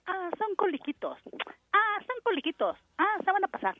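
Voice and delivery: high, light self-imitation brushing it off; airy dismissal. Woman in labor telling herself it's just little cramps, airily dismissive.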